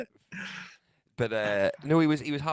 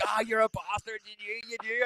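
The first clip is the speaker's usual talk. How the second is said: much higher and softer, mock outrage through giggles; a mock groan from the man who was fooled; caught-out laugh; 11 semitones higher, 7 dB quieter than usual. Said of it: "And he's like".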